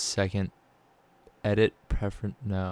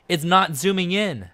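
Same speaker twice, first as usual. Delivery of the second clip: much higher, louder exasperated whine; fed-up yelp at the screen; high loud frustrated complaint; frustrated outburst; 11 semitones higher, 6 dB louder than usual. Real, not acted. Software won't zoom, frustrated.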